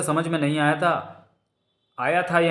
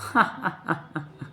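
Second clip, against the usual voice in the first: put-on high, childlike peal of giggles; tinkling little-girl laugh; giggling peal; 5 semitones higher, 4 dB quieter than usual. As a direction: burst into innocent laughter. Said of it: "खिलखिलाकर हंस पड़ी मसाबा".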